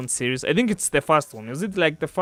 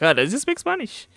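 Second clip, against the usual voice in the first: surprised question; 10 semitones higher than usual. Retold or imitated. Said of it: "they usually like".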